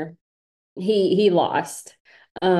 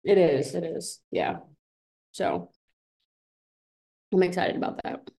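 pretending not to care; 3 dB quieter than usual. Real, not acted. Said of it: "You sound so nonchalant".

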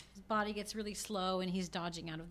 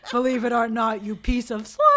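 louder, laughing mock shout of the censored line; laughing TV dub quote; 13 dB louder than usual. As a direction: belt out the dubbed line while cracking up. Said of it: "So we go".

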